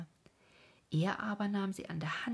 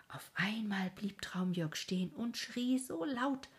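ordinary delivery

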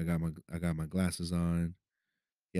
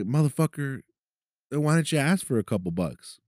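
raised-pitch incredulous inner rant, half-laughing at the absurdity; exasperated disbelief; 8 semitones higher, 3 dB louder than usual. Retold or imitated. Speaker to himself about the mugger, exasperated high inner voice. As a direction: think it in sheer outrage at the mugger's ask. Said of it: "thinking to myself".

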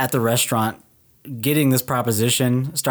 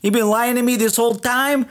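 much higher, hammed-up New York Italian accent; New York Italian guy; 9 semitones higher than usual. Joking about her New York roots, hammed-up New York Italian accent. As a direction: play Uncle Tony's outraged nephew in a thick New York accent. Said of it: "That's New York".

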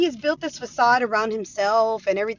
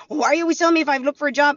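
fast, louder defensive retort; mock defensive whine; 5 semitones higher, 6 dB louder, much faster than usual. Ex-husband snapping back about the job hunt, mock. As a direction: rattle off his irritated comeback. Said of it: "And I kept asking".

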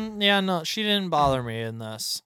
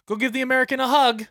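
high, quick imitation of the character; mock-sweet send-off, pitched high; acted film line; 11 semitones higher, 4 dB louder, faster than usual. Retelling how she sends a grenade-strapped kid off, high-pitched imitation.

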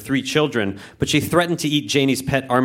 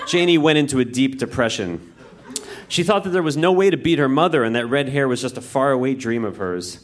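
usual speaking voice

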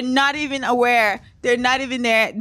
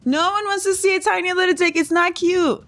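higher, fast, exasperated scoff; scoffing disbelief; 7 semitones higher than usual. Real, not acted. To listeners about the ex's picture, fast high scoff. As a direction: toss it off like it's obvious and gross. Mocking his tiny dick pic.

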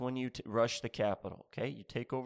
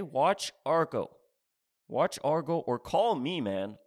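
emphatic push; 3 semitones higher, 6 dB louder than usual. Acted. Urging the crowd emphatically.

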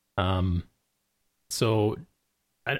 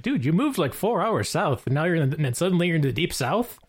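high-pitched teasing disbelief; retold incredulity; 6 semitones higher than usual.

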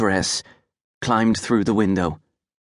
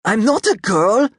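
put-on high, louder boy cutting in; butting in; 12 semitones higher, 5 dB louder than usual. A narrator's reading. Boy butting in to protest to the girl, louder. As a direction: cut in like an offended boy. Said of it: "I butted in".

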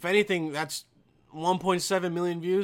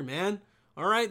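ordinary talk

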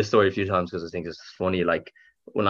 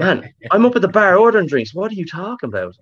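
higher, indignant protest; retold protest; 10 semitones higher, 3 dB louder than usual. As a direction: push back hard on the bouncer, voice climbing. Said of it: "I was like".

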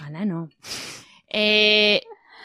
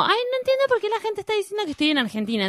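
mocking her post; 9 semitones higher, 5 dB quieter than usual. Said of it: "está diciendo como".

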